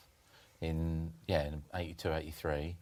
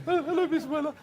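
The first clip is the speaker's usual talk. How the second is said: much higher and louder, giggly and flustered; starstruck younger self; 5 dB louder, faster than usual. Retold or imitated. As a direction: play your dazzled younger self taking a famous call. Nervous answer to John Weller.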